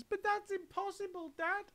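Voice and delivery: baffled little son crying out; tiny squeaky monkey; small monkey. A narrator's reading. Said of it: "cried one of the small monkeys".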